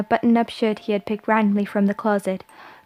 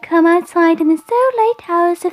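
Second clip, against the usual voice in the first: put-on dreamy phantom child; 10 semitones higher, 6 dB louder than usual. A narrator's reading. Phantom tells the man it watches him nightly, dreamily. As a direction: let each phrase drift like a ghostly child. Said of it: "the voice said dreamily".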